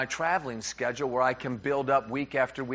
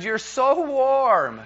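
high, very drawn-out, loud gush; hammed-up honeymoon swoon; imitated gushing wife; 8 semitones higher, 5 dB louder, much slower than usual. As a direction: imitate a delighted new wife, stretching the words. Imitates honeymoon wife warming feet.

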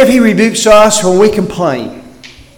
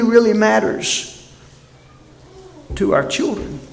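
ordinary talk

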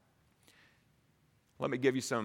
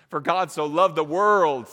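loud, high, driving sermon climax; preaching at full force; 6 semitones higher, 8 dB louder than usual. Acted. Preacher urging the congregation, at full force. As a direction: preach it out with rising fire.

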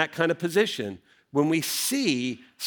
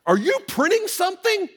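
much higher, squeaky disbelief; incredulous question; 3 dB louder than usual. Retold or imitated. Husband quizzing his wife about the printer, incredulous and high. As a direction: ask it as if you can't believe it. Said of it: "I'm like".

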